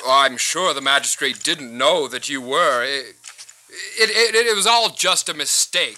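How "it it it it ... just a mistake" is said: flustered, stumbling excuse; nervous stammering. Acted. Official excusing himself to a Roman citizen, flustered. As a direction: trip over your words, backtracking.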